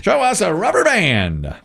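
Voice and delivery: high call with a long falling end; host's suspenseful reveal; game show reveal call. Real, not acted. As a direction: call it out to the board. Game show host calls for the board.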